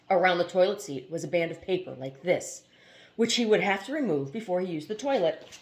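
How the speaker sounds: steady, even reading of a novel; story narration. A narrator's reading. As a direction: read it like an audiobook chapter. Reads novel aloud.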